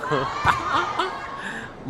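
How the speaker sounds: a high burst of laughter; high amused laugh. Real, not acted. Laughs at benched players.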